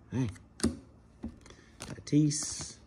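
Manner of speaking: ordinary delivery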